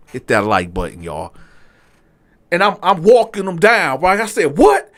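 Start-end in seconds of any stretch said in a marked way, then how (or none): 4.6-4.9 sharp high loud challenge; angry what. Retold or imitated. Diner walking the waiter down, loud and sharp. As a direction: bark it at him.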